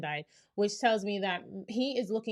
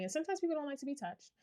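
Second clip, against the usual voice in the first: usual speaking voice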